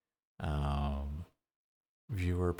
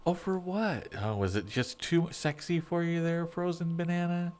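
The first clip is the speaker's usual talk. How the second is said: teasing high voice; 10 semitones higher than usual. Acted. Teasing the frozen banana item.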